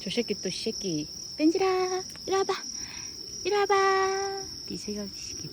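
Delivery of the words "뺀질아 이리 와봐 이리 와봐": high coaxing call to the dog. Acted. Owner coaxing sulking dog over, high voice. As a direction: sing it up high to lure him.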